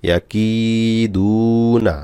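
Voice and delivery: "কি" and "দু" held long, "না" short; one-alif stretch. Shown as a demonstration. Teacher demonstrating madd letters, long "কি" and "দু", short "না". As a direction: draw out the vowels of "কি" and "দু". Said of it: "কাফে এক আলিফ টান".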